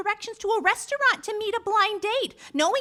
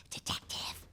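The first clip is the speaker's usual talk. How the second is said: whisper; 15 dB quieter, slower than usual. Acted. Secretary whispers through to detective.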